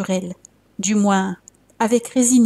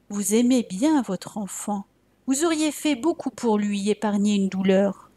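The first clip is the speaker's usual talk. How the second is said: ordinary delivery, nothing marked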